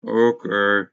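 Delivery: deep slow robot drone. Acted. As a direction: speak as a low cleaning robot. Robot character answers in deep voice.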